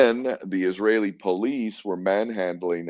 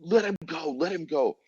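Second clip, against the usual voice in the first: high-pitched shout of protest at the police; retold shouting; 11 semitones higher, 4 dB quieter than usual. Protesters shouting at police manhandling a friend, high retold yell. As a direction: call out to make them let go, pitched up high. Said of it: "and we're shouting".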